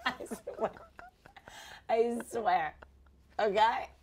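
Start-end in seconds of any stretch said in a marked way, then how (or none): none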